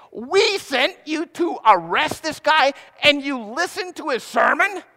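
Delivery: high, loud, slow outraged scolding; purple with rage. Retold or imitated. Religious leaders berating guards who listened instead, outraged. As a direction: sputter it in disbelief and fury. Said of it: "turning purple and saying".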